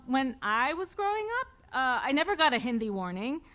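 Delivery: normal talking voice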